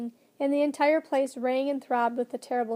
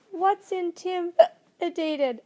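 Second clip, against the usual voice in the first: slow, higher-pitched puzzled question; little girl asking; 6 semitones higher, 4 dB louder, much slower than usual. A narrator's reading. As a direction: sound out the unknown word haltingly. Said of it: "asked Lindy".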